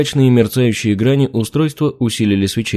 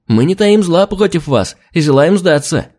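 higher, hurried plea to surrender; 6 semitones higher than usual. Acted. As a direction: rush it out, eager to please. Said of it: "торопливо возвестил Серый".

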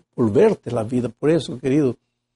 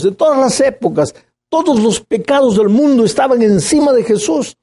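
higher, louder full-force preaching; fiery preaching; 6 semitones higher, 3 dB louder than usual. Acted. Preacher to his flock on Christ's sacrifice, fervent and raised. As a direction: drive the cross home to the congregation.

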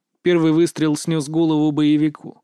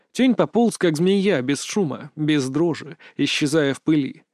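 plain reading voice

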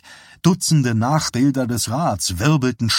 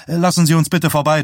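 fast, loud, raised plea; urgent request; 6 semitones higher, 5 dB louder, faster than usual. A narrator's reading. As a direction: push through the crowd urgently. Johnny urges crowd to make way. (dringend und hastig)

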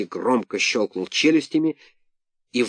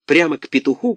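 ordinary delivery, nothing marked

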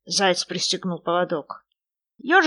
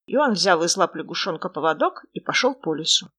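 ordinary delivery, nothing marked

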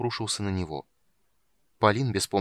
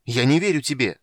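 loud, higher cry of disbelief; angry shout; 4 semitones higher, 8 dB louder than usual. A narrator's reading. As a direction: hurl it at him. Palin keeps shouting refusal.

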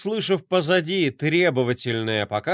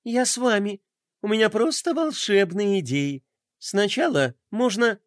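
put-on bubbly sorority girl; 6 semitones higher than usual. Pinky's bubbly enthusiasm.

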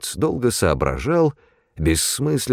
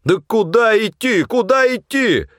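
high, desperate yell; yelling over wind; 14 semitones higher, 4 dB louder than usual. A narrator's reading. Supply man protesting to departing companions, yelling over the wind. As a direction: shout to be heard through a howling storm. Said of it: "Перекрывая вой ветра, заорал снабженец".

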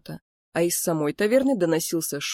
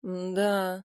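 unimpressed, doubtful murmur; sceptical; 4 dB quieter than usual. A narrator's reading. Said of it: "фыркнул Луи, скептическим взглядом осматривая". (скептически, без восторга)

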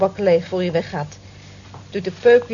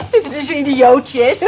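laughing; 7 semitones higher, 7 dB louder than usual. Acted. Laughing while denying being smitten.

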